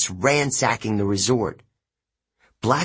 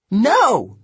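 much higher, louder cry; scream; 11 semitones higher, 6 dB louder than usual. A narrator's reading. Sorceress watching the pirates break free, screaming. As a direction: shriek it as if all is lost. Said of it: "Circe screamed".

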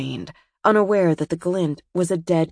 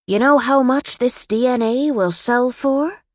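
high, reedy villain gloat; smirking taunt, pitched high and rising at the end; Smiles; 7 semitones higher, 4 dB louder than usual. A narrator's reading. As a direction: gloat in a thin, high henchman voice. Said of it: "his voice high and reedy".

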